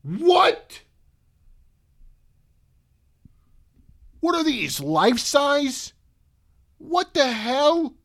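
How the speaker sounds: high, loud, stunned questions; can't believe what you see; disbelief. Real, not acted. Reacting to the price.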